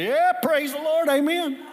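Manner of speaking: put-on high, loud drunk hollering amen; tipsy congregant yelling praise from the back pew; drunken shout.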